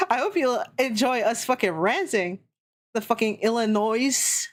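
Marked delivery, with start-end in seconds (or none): 3.4-4.5 "Illinois" said "ill-i-NOIZ"; Illinois with sounded S. Shown as a demonstration. Streamer griping at spelling, "Illinois" as "ill-i-noiz". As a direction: say "Illinois" with the final s buzzed. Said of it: "why is the S there".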